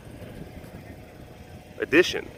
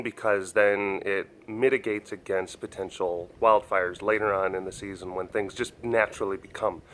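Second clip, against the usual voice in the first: ordinary delivery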